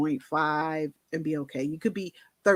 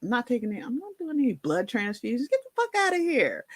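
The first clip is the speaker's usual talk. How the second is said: defiant refusal; 6 semitones higher, faster than usual. Retold or imitated. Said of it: "And I was like".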